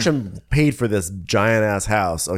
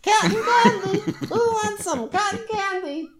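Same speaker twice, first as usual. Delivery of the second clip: very high laughing vendor call; sing-song hawker up high; cotton candy vendor. Pretend. Joins vendor bit.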